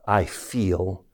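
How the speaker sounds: slow soft whisper; barely voiced, slow and inward. Shown as a demonstration. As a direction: whisper it like a private certainty. Conviction shown in a hush.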